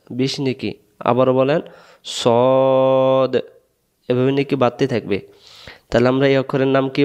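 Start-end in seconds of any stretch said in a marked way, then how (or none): 2.2-3.3 "সদ" with one long rounded "o"; rounded-mouth pronunciation. Shown as a demonstration. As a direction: round the mouth and hold "সদ" as a drawn-out "sawd". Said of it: "মুখটা গোল হবে". ("সদ" মুখ গোল করে লম্বা টেনে)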